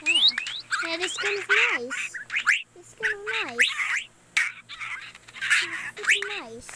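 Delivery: playful high-pitched delight; high silly voice. Acted. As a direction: squeak it out pleased.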